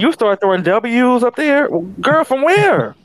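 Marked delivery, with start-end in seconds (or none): 2.0-3.0 incredulous. Retold or imitated. Mocking odd name spellings.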